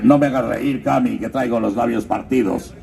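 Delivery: normal talking voice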